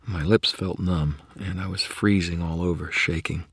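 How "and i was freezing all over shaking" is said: ordinary delivery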